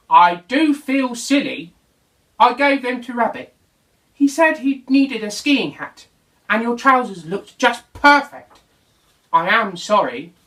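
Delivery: owning up feeling silly; Dog sheepish. Acted.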